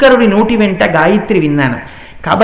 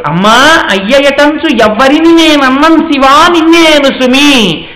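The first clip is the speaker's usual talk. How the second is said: slow, raised verse chanting; poem recitation; 5 semitones higher, 4 dB louder, slower than usual. Acted.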